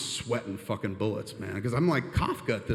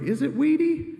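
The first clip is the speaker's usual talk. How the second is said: squeaky question; 4 dB louder than usual. Pretend. Asking weed inner voice.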